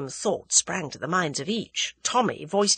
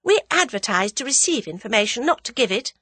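mildly severe, higher-pitched retort; 6 semitones higher than usual. A narrator's reading. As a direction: put him in his place, a touch severely. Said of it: "said Tuppence severely".

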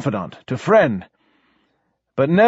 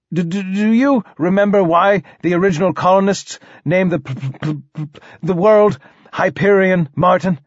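higher, questioning voice full of snags; stuttering king; 5 semitones higher than usual. Acted. King quizzes poet on Hyperion's name, stuttering. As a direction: stammer through a leading question.

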